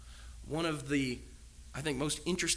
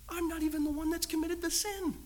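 much higher, whining protest; mock whine; 14 semitones higher than usual. A family member protesting the blame, high mock whine. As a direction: complain you're being unfairly blamed.

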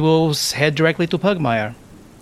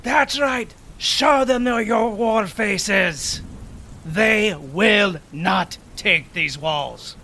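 high, slow, carrying rally cry; defiant war captain urging his men on; rousing commander; 8 semitones higher, much slower than usual. Acted. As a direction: stir the troops with each word. Rallying soldiers on the wall.

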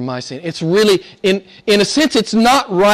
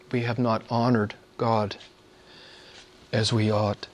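much softer, hushed confession; soft repeat; 9 semitones lower, 11 dB quieter than usual. Shown as a demonstration. Preacher repeating the confession to congregation, hushed. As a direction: drop to a hush and admit it.

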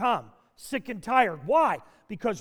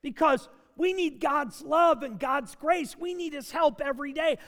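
ordinary talk

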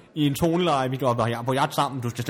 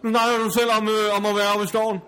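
high-pitched, accusing comeback; indignant retort; 7 semitones higher than usual. Acted. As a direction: pounce on the slip in a raised pitch. Quarrel, catches opponent out. (anklagende svar i højt toneleje)

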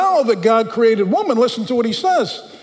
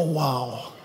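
softer awed wow; 9 semitones lower, 8 dB quieter than usual. Retold or imitated. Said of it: "I still go".

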